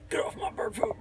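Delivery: hushed unvoiced whisper. Real, not acted. Hiding in the store fort from an employee, whispering. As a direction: whisper so staff don't hear.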